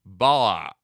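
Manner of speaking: loud call of the name Bala. Real, not acted. Calling out a viewer's name.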